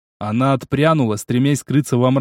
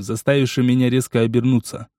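usual speaking voice

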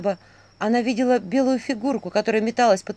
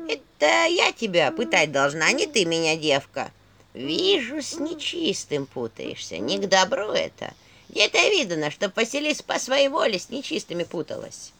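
put-on creaky old woman's skull voice; скрипучий голос черепа. A narrator's reading. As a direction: scold like an ancient crone skull. Skull voice held. (скрипучий старческий голос черепа)

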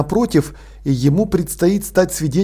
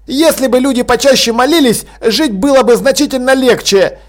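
high, raised arguing voice; heated, pitched-up protest; arguing dormitory boy; 8 semitones higher, 4 dB louder than usual. A narrator's reading. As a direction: argue back hotly until cut off.

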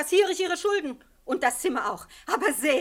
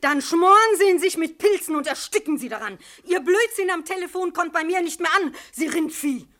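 loud, scolding outburst; landlady snapping at a caller she thinks is a prankster; angry telephone rant; 4 semitones higher, 8 dB louder than usual. Acted. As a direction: tell off the prankster furiously.

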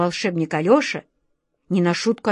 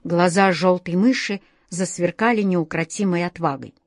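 plain reading voice

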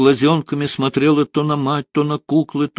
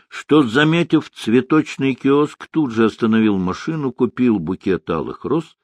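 ordinary delivery

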